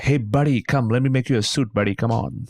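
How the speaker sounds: hammed-up Yankee salesman pitch; bad American accent. Dad hustling American customers into the tailor shop, bad American accent. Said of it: "if it's the American customers, he'll be like".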